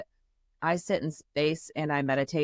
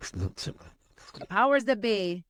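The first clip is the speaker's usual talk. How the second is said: mock solemn; 3 semitones higher, much slower than usual. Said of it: "I'm gonna go".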